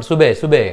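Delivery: "సుబే" twice, bah softened to bay; colloquial "సుబే". Shown as a demonstration. Teacher showing what became of bah, "సుబే" as su-bay. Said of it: "బెహ్ చేశారు అన్నమాట".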